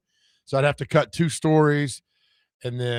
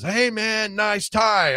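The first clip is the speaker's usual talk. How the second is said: mock fan; 7 semitones higher, much slower than usual. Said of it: "a fan says".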